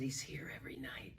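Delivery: quick confidential whisper; hushed gossip; whispered aside. Retold or imitated. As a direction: lean in and whisper it.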